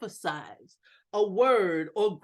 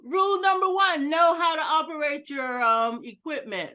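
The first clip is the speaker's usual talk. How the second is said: mock proclamation; 5 semitones higher, 4 dB louder than usual. Mock-stern rule on tech failure.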